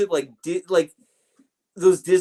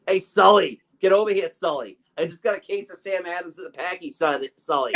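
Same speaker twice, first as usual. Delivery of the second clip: put-on a lightly done Boston accent, calling across the bar; hamming up a Boston local shouting for his buddy in a Boston accent; Boston Sully accent; 2 semitones higher than usual. Podcaster mocks Boston Sullivans on Twitter, light Boston accent. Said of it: "it's like the Boston Sullivans".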